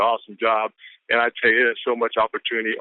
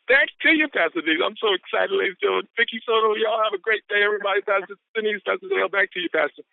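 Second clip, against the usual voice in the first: excited sign-off; 9 semitones higher than usual. Real, not acted. Excited handoff to host.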